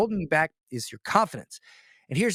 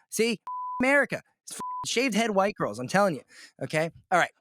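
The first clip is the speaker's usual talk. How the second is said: ordinary delivery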